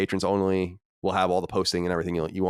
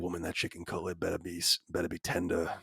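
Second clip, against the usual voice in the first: fake Southern man; 5 semitones lower, 11 dB quieter than usual. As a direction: play a fake Southern husband, low and quiet. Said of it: "that's my Southern man".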